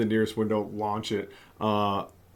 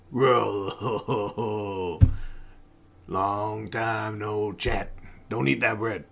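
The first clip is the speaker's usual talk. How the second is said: slow, laughing stoned drawl; dazed stranger; much slower than usual. Acted. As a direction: wake up slowly and giggle it out. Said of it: "his eyes slowly open and goes".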